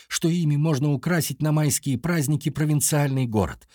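usual speaking voice

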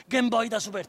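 gridato e lento, più forte; strillo capriccioso. Acted. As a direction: chiederlo strillando. Bambino strilla che vuole gioco. (il figlio strilla alla madre per avere il Game Boy)

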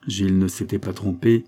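usual speaking voice

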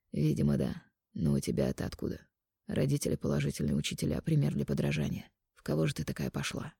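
soft, low man's voice; quiet intimate male lover. Acted. Mark teases heroine.

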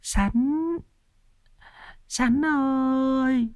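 very high, slow, soft drawn-out wail; keen his name like a mad grieving woman; tearful wailing call. Acted. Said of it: "Chị Sắn mếu máo gọi". (mếu máo gọi, giọng rất cao, kéo dài, chậm và nhỏ)

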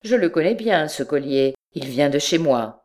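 ordinary delivery

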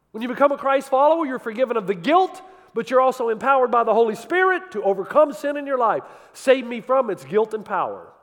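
loud, high, fervent preaching; preaching at full force. Acted. Preacher quoting a hymn to the congregation, loud and fervent. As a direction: preach the hymn lines out to the back row.

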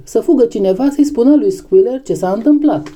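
high-pitched, pressing order; urgent alarm. A narrator's reading. Clover sending a runner to Squealer, urgent. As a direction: send someone off in a hurry.

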